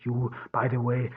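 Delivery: usual speaking voice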